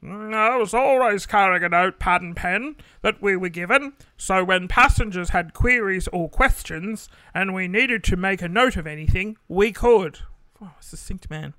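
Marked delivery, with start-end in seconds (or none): 0.0-10.2 loud put-on old man voice. Imitating dad as an old man explaining his notepad. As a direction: speak as your elderly dad. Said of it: "How do you do an old man?".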